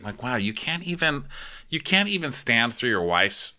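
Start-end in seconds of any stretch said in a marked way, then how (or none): none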